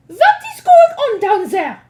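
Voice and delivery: high loud witch shout. Acted. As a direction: bellow down from the stage. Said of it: "shouted the Grand High Witch".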